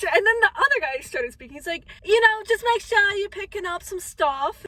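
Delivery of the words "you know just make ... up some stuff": lightly put-on British accent, higher and louder; mock British accent. Vlogger imitating a second British volunteer in a light British accent. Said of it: "congrats on being British".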